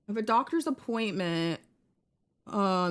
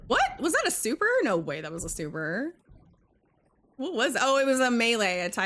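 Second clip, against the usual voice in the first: ordinary talk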